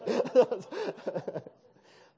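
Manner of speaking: big open laugh, high and loud; a loud laughing fit; loud burst of laughter. Real, not acted. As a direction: let out a loud hearty laugh. Laughing after awkward story.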